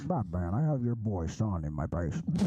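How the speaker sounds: low mock villain taunting Batman over the phone. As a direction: growl the threat like a cartoon villain. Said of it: "If you want your child back".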